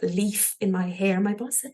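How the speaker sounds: usual speaking voice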